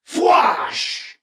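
high, airy whoosh for an energy shot; rifle blast sound. A narrator's reading. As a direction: mouth a sharp laser-gun blast. Narrator voices rifle blast sound effect.

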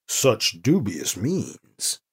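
slow, mock-solemn delivery; mock gravity. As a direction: say it with pretend seriousness. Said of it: "with mock gravity".

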